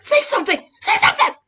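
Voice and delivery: high, desperate urging; mock pleading. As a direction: beg him to speak. Demanding husband respond, upset.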